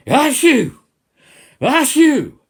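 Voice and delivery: huge, high, explosive fake sneeze; mock sneeze. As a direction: sneeze very loudly on purpose, twice. Mimes sneezing germs over listeners.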